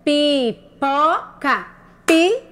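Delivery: PIPOCA broken slowly into PI, PO, CA; syllable by syllable. Shown as a demonstration. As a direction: sound out "Pipoca" in separate, stretched syllables. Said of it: "Qual é a sílaba".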